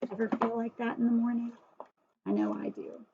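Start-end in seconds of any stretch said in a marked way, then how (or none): 2.2-3.1 a much lower, groaning aside. Real, not acted. Reader confiding to children, low groggy groan. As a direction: drop the voice low like a groggy morning.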